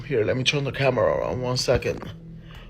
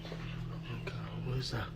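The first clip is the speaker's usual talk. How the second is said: terrified whisper; 15 dB quieter than usual. Real, not acted. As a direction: breath-only whisper in fear.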